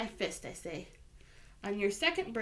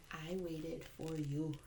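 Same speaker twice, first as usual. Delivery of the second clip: tender grandfather; 5 semitones lower, 7 dB quieter, slower than usual. Acted. Grandfather tells of waiting tenderly.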